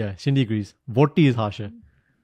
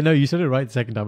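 normal talking voice

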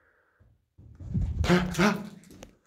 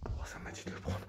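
hushed whisper; voiceless report to the team; 18 dB quieter than usual. Real, not acted. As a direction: keep it under the breath. Hushed in a dark house.

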